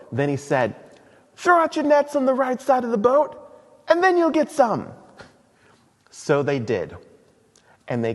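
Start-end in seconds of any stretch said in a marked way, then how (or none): 1.4-4.9 high, loud Monty Python British voice; hammed-up British Jesus giving advice; British accent. Jesus telling fishermen where to cast in a British accent. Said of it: "maybe Jesus had a British accent".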